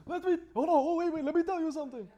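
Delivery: high loud impression voice. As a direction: squeal it like the video guy. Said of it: "You seen that video of that guy".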